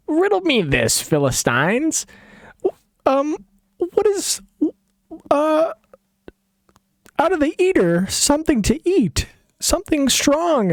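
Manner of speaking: high, theatrical riddler voice; showy riddler Samson. Acted. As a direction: pose the riddle like a smug showman. Samson poses riddle to in-laws.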